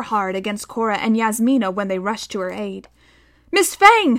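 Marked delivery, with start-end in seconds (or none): 3.5-4.2 high loud cry of alarm; boy crying out to the fighting aviator; shouting. A narrator's reading. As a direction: call out to her in fear. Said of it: "shouted Tom".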